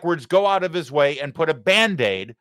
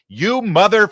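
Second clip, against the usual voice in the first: higher, louder cut-off curse; mock angry yell; 6 semitones higher, 6 dB louder than usual. Host showing what an angry Midwesterner really means, mock yell. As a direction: bark the curse then bite it off. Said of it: "somebody's angry and they yell".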